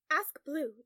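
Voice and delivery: loud, pressing command; urgent. A narrator's reading. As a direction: push it out insistently. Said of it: "Tom said urgently".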